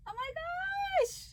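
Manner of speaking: high, stretched-out squeal; delighted squeal. Real, not acted. Seeing the sweet card, delighted squeal. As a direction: squeal slowly, overjoyed.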